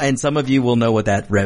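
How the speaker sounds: ordinary delivery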